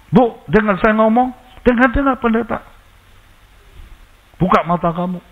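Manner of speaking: high, insistent calling out; commanding call. Retold or imitated. Retold calling to possessed woman.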